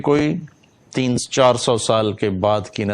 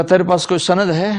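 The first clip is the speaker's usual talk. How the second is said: raised, louder retold demand; demanding challenge; 9 semitones higher, 5 dB louder, faster than usual. Sardar asks a destitute woman for proof, demanding. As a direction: challenge them sharply for proof, pitch up.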